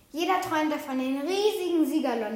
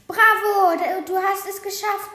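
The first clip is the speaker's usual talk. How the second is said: louder, higher croaking cheer on bravo; cheering raven; 6 semitones higher, 7 dB louder than usual. A narrator's reading. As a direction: cheer the boar over the wolf. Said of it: "krächzte der Rabe". (jubelnder Rabe)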